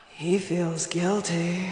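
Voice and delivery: low, very slow mock accusation; loud, dragged-out damning answer; drawn-out suspicious verdict. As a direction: stretch each word out, low and knowing. Mock cheating-article verdict after question.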